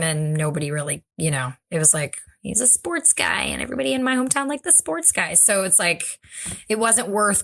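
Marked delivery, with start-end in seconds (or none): 2.4-5.3 mimicking hometown fans. Mimicking hometown fans' excuse.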